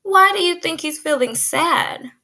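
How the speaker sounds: usual speaking voice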